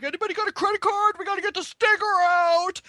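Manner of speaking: hammed-up urgent lifeguard asking around; imitating the lifeguard. Lifeguard quoted in sting story.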